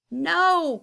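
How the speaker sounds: sharp high cry of protest; cried out. A narrator's reading. Tyree cries out at the troublemakers, high and loud. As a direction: cry out to stop them. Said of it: "cried Tyree".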